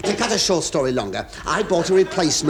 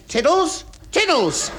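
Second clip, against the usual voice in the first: calling the dog; 11 semitones higher, slower than usual. Retold or imitated.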